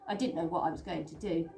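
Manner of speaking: plain reading voice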